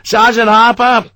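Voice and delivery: loud, high long-distance call. Acted. Sharp calls for his missing sergeant, shouting. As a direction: hands cupped, call across the square. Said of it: "He cupped his hands".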